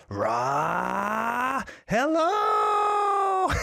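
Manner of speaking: a hammed-up Dragon Ball shout; mock shout. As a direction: belt it out like a cartoon battle cry. Reading a viewer's shouted greeting.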